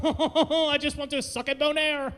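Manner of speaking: high, laughing mock French accent; hammed-up French with a comic chortle. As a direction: chuckle through a caricature French accent. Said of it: "I love the accent".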